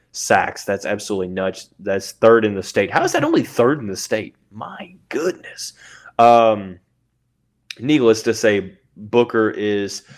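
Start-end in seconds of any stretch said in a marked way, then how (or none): 4.5-5.7 breathy whispered aside. Real, not acted. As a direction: whisper it in disbelief. Stunned by a stat.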